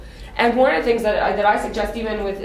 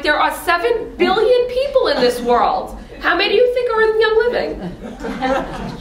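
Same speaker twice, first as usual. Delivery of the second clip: higher, laughing disbelief; incredulous; 8 semitones higher than usual. Retold or imitated. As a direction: toss it back with an amused laugh. Incredulous retort to a recruit.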